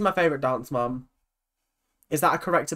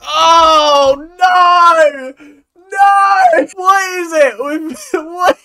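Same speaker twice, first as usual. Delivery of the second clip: high, loud, drawn-out cry of dismay; appalled wail; 12 semitones higher, 11 dB louder, much slower than usual. Real, not acted. Reacting to the dancers' costumes, appalled wail.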